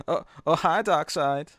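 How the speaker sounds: lightly voiced Wiseau drawl; a hint of Tommy Wiseau's odd accent; Tommy Wiseau impression. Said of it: "I'll just go full Tommy Wiseau".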